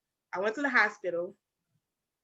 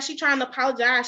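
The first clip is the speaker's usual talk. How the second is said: ordinary talk, nothing marked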